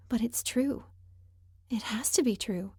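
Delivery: whispered, unvoiced, to herself. A narrator's reading. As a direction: breathe it as a bitter truth.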